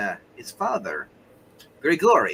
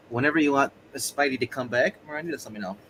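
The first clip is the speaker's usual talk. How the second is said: normal talking voice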